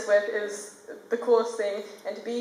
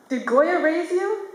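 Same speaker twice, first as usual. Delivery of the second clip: teacher's question; 6 semitones higher, much slower than usual. Retold or imitated. Teacher challenges student's influences.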